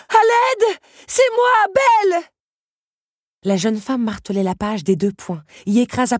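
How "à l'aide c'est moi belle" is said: high, full-voiced desperate yell; screaming for help. Young woman calls her allies for help, screaming. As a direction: scream it at the top of your lungs. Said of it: "s'égosilla-t-elle".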